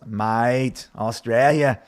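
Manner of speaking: lightly put-on Australian accent. Shown as a demonstration. Host playfully greeting a viewer from Western Australia, Australian accent. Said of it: "that's, that's more Australian".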